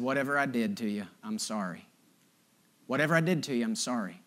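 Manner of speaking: low, soft, measured apology; calm, controlled. Retold or imitated. Buddy apologizing to the man confronting him, calm and controlled. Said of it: "He said in a calm, controlled voice".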